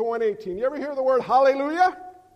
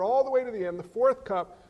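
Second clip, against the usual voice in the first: ordinary talk, nothing marked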